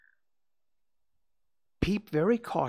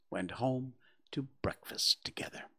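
ordinary delivery

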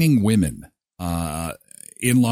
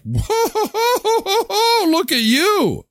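high, laughing amazement; delighted surprise, very high; amazed laugh; much slower than usual. Retold or imitated. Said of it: "And I'm like".

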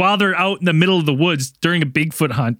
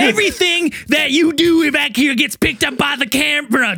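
higher, bossy scolding voice; Zell lecturing; 11 semitones higher than usual. Retold or imitated. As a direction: scold the crew like a boss who is fed up. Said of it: "I thought it was Zell yelling at us".

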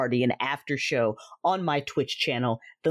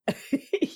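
quick laugh; 10 semitones higher than usual. Acted. Teasing sister laughs.

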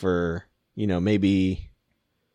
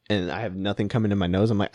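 usual speaking voice

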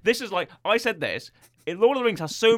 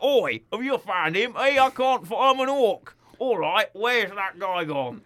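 high, hammed-up Cornish farmer voice; mock West Country orc, with a touch of Cockney; Cornish farmer accent; 5 semitones higher than usual. Podcaster imitating the orcs, hammed-up Cornish farmer accent. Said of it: "Cornish farmer accent there".

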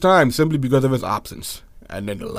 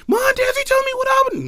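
much higher, pleading younger brother; brother's voice. Retold or imitated. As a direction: beg the sister for the secret. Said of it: "I hear my brother and my sister".

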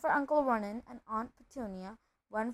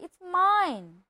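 higher-pitched, possessive protest; indignant claim; 8 semitones higher, 4 dB louder than usual. Acted. Nephew claiming his own letter, indignant. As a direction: stake your claim crossly. Boys quarrel over the letter.

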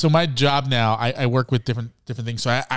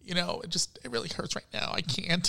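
pained mock murmur; 3 semitones higher, 11 dB quieter than usual. Host re-enacting a sitcom character, softly pained. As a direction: play hurt, small and quiet. Acting out Ross struggling in Dutch.

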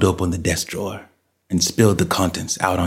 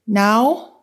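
put-on high-pitched child calling back; young boy's voice; 3 dB louder than usual. A narrator's reading. Son answers mother from upstairs, high boyish voice. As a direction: answer as a small boy, pitched way up. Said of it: "Tommy's voice came".

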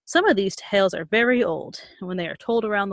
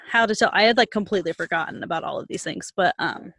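ordinary delivery, nothing marked

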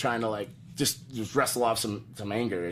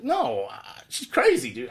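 much higher, alarmed; high panicky refusal; 3 semitones higher, slower than usual. Retold or imitated. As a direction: refuse it up high, half-scared. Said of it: "And I'm like".

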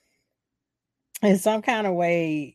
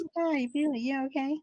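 mock fake concern; 8 semitones higher, 5 dB quieter, faster than usual. Sidney voiced with fake concern.

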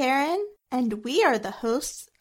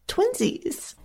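cutesy giggle; 10 semitones higher than usual. Pretend. Giggly cute twinsies.

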